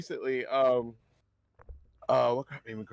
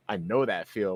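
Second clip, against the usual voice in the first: ordinary delivery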